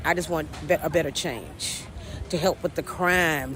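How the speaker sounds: ordinary talk, nothing marked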